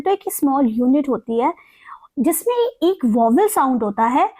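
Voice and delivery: whispered explanation. Real, not acted. Teacher whispering the definition to her class.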